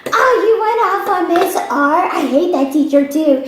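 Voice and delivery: high, gushing schoolkid; excited kid voice. Retold or imitated. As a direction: gush like an eager classmate. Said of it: "They're like".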